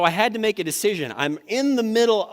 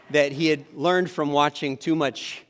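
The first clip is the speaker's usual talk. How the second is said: normal talking voice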